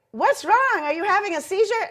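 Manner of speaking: high, loud worried call; alarmed shout. Retold or imitated. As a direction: yell it up high like a scared guard. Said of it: "He's like".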